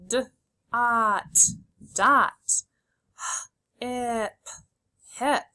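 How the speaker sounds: "D", pause, held "ot", then whole "dot"; sounding out, blending. Shown as a demonstration. Teacher keeps children saying the sounds, segmented blending. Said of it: "Keep saying the sounds".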